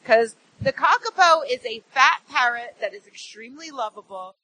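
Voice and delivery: ordinary talk, nothing marked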